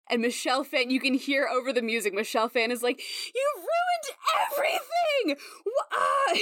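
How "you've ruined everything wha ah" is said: screaming and crying. Acted. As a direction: shriek it through tears. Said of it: "screaming and crying and sobbing".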